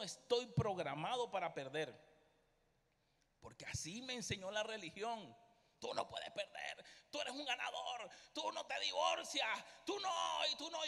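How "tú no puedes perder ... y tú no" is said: preachy commands. Retold or imitated. Quoting what religion taught him.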